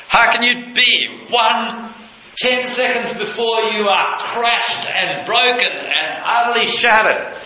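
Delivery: soar up with wonder at the joy; a pastor marvels aloud at Mary's ecstasy; ecstatic. Real, not acted. Preacher's ecstatic climax.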